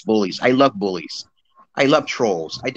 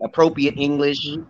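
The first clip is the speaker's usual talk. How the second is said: ordinary talk, nothing marked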